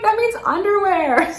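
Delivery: high, loud mock-shocked friend; shocked correction. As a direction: blurt it out aghast, half laughing.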